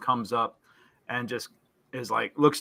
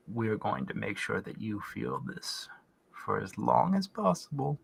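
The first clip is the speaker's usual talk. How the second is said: devil's voice; 3 semitones lower, 8 dB quieter than usual. Acted. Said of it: "they laugh and they say".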